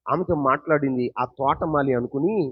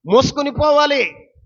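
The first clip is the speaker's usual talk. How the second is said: preaching shout; 10 semitones higher, 9 dB louder than usual. Real, not acted. Repeated phrase, loud take.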